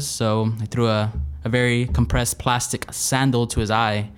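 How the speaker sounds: ordinary talk, nothing marked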